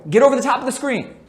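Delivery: loud, high, fast instruction shout; court call. Shown as a demonstration. Coach shouting to a teammate to fight over the screen, loud. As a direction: bark it across the court. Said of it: "say what your teammates should be doing".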